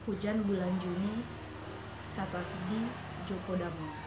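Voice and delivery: measured poetry reading with long pauses; understated, unhurried verse reading; poem recitation. Acted. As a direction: recite it in short, spaced phrases, letting each line end fall away. Recites the poem before chatting.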